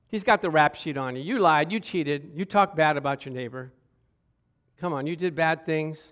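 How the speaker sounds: normal talking voice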